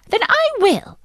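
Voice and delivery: wife overruling her timid husband; defiant retort. Acted. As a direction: toss it back at him, defiant.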